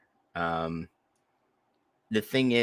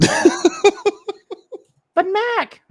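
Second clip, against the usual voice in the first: put-on high, laughing childish whine; whiny kid; 8 dB louder than usual. Kid pleading, voiced by host.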